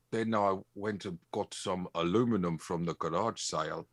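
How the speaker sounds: amateur New Zealand impression; New Zealand accent attempt. Shown as a demonstration. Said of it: "Can you do a New Zealand accent?".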